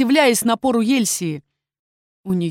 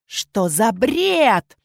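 slow, drawn-out angry question; understated snarl; growled disbelief; 3 semitones higher, much slower than usual. A narrator's reading. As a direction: drag it out with mild fury. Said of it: "рычала Ельсия".

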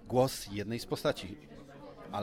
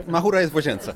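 laughing aside; 4 semitones higher, 8 dB louder than usual. Real, not acted. Host laughing at joke.

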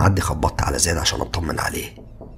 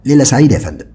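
a hint of polite waiter; 8 semitones higher, 8 dB louder than usual. A narrator's reading. Hotel waiter voiced.

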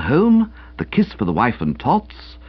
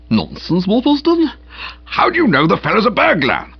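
much higher, offended disbelief; taken aback; 9 semitones higher than usual. A narrator's reading. Boco, rebuked by Uncle Percy, answers in offended surprise. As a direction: sound wounded that he's doubted.

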